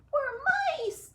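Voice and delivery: very high, loud squeak. Acted. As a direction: squeak it out like scared mice. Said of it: "they squeaked".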